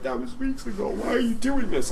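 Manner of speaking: high, soft mock-tearful pleading; imitated crying. Friend acting out a pinned pal's protest, high mock crying. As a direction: whine it small and high, like bawling. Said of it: "And he's just crying".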